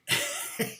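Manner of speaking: squeaky high laugh; amused falsetto laugh; high giggle. Real, not acted. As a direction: burst into a tiny high giggle. Laughing at the story.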